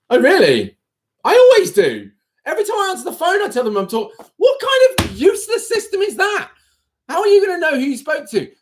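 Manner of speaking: very high, incredulous outburst; indignant disbelief. Real, not acted. Streamer appalled at nameless college phone answering, indignant. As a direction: squeak with outraged disbelief.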